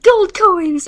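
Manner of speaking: loud, high cartoon yell. Scrooge McDuck throwing coins, loud high yell. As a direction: shout it with glee. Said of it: "Scrooge McDuck flew up and yelled".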